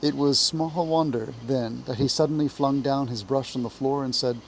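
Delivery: usual speaking voice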